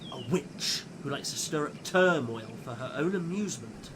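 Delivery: plain reading voice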